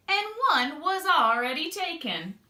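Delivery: ordinary delivery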